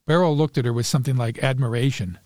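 ordinary delivery

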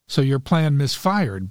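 plain reading voice

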